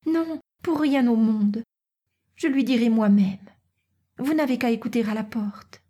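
put-on high girlish voice, gently insistent; determined young Natacha.

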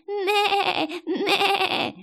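long, high, quavering bleat; sheep's bleat. A narrator's reading. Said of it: "s'acheva en un long bêlement".

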